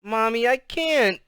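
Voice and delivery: put-on high, slow childish wail; drawn-out sobby protest; tearful. A narrator's reading. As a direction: plead like a little girl starting to cry. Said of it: "The tears really started coming".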